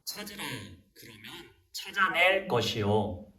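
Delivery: slow, measured Bible recitation; scripture reading. Acted. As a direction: read it out deliberately for children to repeat. Leads children's scripture reading.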